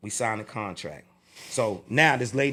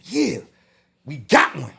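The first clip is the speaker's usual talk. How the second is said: retold excited yes, louder and higher; eager agreement; 5 semitones higher, 5 dB louder than usual. Teller replays saying yes to the Jamaica offer, eagerly. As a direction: jump on the offer with mock glee.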